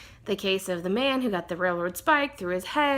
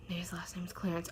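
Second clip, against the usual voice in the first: quick whispered mutter to oneself; whispered aside; 4 semitones lower, 14 dB quieter, faster than usual. Real, not acted. Vlogger trying to recall a voice actor's name, whispered aside. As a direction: drop to a hurried whisper, thinking aloud.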